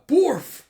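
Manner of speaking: very loud, high mock woof; attacking dog bark. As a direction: bark fiercely as the dog pounces.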